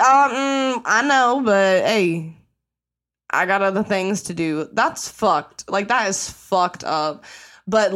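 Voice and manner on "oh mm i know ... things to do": mock brush-off. Mocking the cop who shrugs off the father, breezy loud brush-off. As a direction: toss it off like it's no big deal. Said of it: "you were like".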